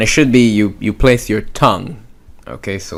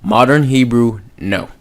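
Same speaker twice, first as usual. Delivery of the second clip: normal talking voice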